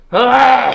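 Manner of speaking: sharp high shriek; sudden scream. Acted. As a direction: let out a piercing yelp. Sudden scream after lip noise.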